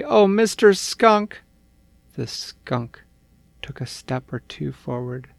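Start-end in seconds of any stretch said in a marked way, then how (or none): 0.0-1.4 even higher, louder call. Acted. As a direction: shout for the skunk. Calling again to the skunk.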